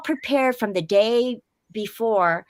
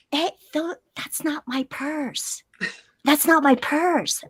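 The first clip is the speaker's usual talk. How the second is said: hushed, drawn-out mock objection; retold quiet protest; 5 dB quieter than usual. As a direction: object softly, as if not waking the neighbors. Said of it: "we're trying to be quiet".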